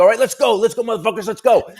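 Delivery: loud high challenge to fight; comic leaving stage to fight hecklers; fight call. Retold or imitated. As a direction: call them all out, pumped. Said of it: "I go".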